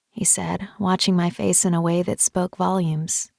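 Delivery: usual speaking voice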